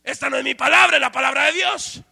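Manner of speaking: loud fervent preaching; full-force sermon voice. Acted. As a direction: preach it out to the congregation. Preaching opening.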